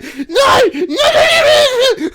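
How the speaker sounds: very high, loud whining cry of no; frightened dog crying out denials at its owner; panicked dog wail. Retold or imitated. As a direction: wail like a terrified puppy. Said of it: "And it's like".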